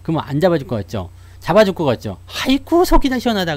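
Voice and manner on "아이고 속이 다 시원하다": high, gloating sigh of relief; mock the spouse glad to be rid of you; relieved spouse. Spouse left at home after a fight, glad and gloating, high.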